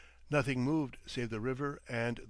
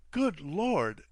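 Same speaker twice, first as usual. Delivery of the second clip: startled exclamation, a little higher and louder; young man startled at slimy stone stairs; exclaiming; 4 semitones higher, 4 dB louder than usual. A narrator's reading. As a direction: blurt it out in shock. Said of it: "exclaimed the young man".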